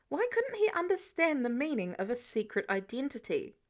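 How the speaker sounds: plain reading voice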